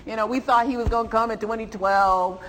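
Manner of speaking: mounting sermon delivery; building preacher's voice. Acted. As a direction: build the story toward the climax.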